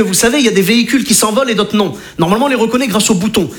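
ordinary talk, nothing marked

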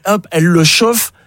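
ordinary talk

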